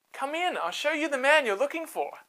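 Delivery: high, knowing invitation; Jael inviting. Acted. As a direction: show him the body calmly. Jael greets Barak.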